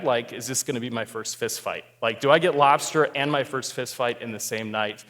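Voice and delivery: normal talking voice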